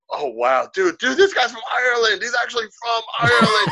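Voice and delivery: high, loud, awestruck dude; amazed drunk bar patrons. Retold or imitated. Bar crowd marvelling at the supposed Irish visitor, excited shouting. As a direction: gush loudly with astonishment.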